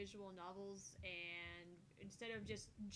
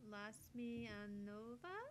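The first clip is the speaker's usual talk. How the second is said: drawn out; much slower than usual. Acted. Slow drawn-out reading of name.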